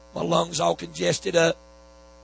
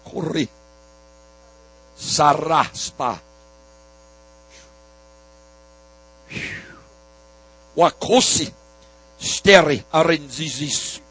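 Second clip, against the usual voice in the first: speaking in tongues; 5 dB louder, slower than usual. Real, not acted. Speaking in tongues during prayer.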